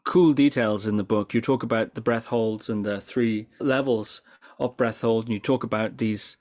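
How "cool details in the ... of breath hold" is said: normal talking voice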